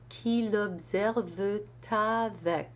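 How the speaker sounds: l'observe avec with the T linked into avec; liaison. Shown as a demonstration. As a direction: carry the T into avec. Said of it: "T at the end of E N T makes a liaison".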